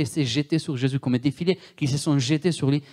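normal talking voice

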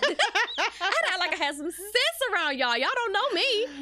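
high, quick, through laughter; giggly, fast and high-pitched; laughing. Real, not acted. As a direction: laugh while rushing the line out. Joking with friend, laughing.

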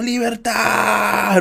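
long-drawn, high cry at moderate volume; imitated battle cry. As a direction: stretch it out like a hero's mock war cry. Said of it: "así como gritando". (grito de guerra imitado, agudo y alargado)

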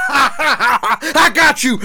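slow laughing gloat; gleeful laughing. Real, not acted. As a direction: laugh it out slowly. Prank success laughter.

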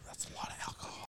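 barely audible whisper; very soft, voiceless aside; hushed disbelief. Real, not acted. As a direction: whisper it under your breath. Hushed reaction to heavy drinking.

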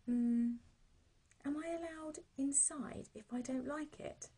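put-on high, unsure little boy; a worried little boy asking; hesitant child voice.

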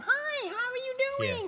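extremely high sing-out greeting; Disney worker voice. Retold or imitated. Imitating every park worker greeting guests, squeaky put-on cheer. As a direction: chirp it like a cartoon hostess. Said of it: "everyone's like".

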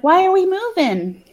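put-on high, questioning child voice; little girl. Little girl asking mom about the move, high child voice. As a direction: ask as a puzzled small kid. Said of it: "the little girl's like".

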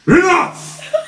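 sudden high angry shout; furious shout. Acted. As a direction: snap at them in rage. Villain snaps.

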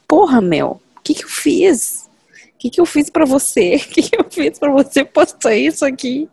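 high, loud indignant complaint; mock outrage. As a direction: rant at the poster in disbelief. Acted outrage at vacation post.

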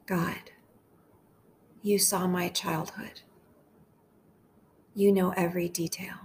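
gentle, unhurried meditation voice with long pauses; hushed, measured devotional leading; guided prayer meditation. Acted.

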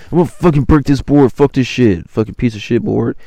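loud, high mock rage at the board; hammed-up fury of a frustrated skater; angry skater. Frustrated stranger smashing his board.

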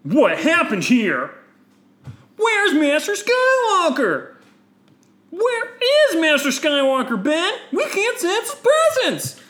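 very high, loud, slow demand; hammed-up high cry of alarm; demanding Jedi. Three Jedi confront Ben about their master, high demanding voice.

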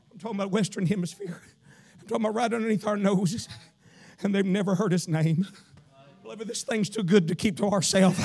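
ordinary delivery

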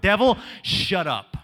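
slow, deliberate rebuke; slow firm command. Pretend. Preacher closing the rebuke to the devil, slow and firm. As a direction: lay down the final word. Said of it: "a generation that says".

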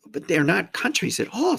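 ordinary delivery

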